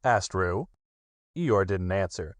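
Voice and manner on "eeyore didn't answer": plain reading voice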